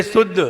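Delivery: mimicking शुद्ध as "suddh"; weak "s", no whistle in शुद्ध; faulty plain "s". Teacher mimics those who cannot say it, शुद्ध as "suddh". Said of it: "जो शुद्ध ही नहीं बोल पाया".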